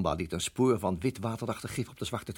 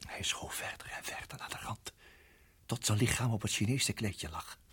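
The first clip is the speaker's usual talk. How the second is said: usual speaking voice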